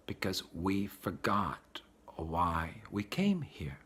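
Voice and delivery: slow, hushed whisper. Real, not acted. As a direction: lean in and breathe the words. Solemn point of sermon.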